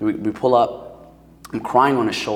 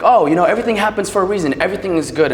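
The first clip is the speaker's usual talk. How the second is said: much higher, louder, fast upbeat pep talk; motivational speaker facade; 9 semitones higher, 8 dB louder, faster than usual. Retold or imitated. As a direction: put on a forced cheery coach voice. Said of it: "It was the motivational speaker".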